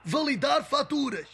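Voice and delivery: frustrated shout. Acted. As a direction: yell it at the machine. Shouting at the bot.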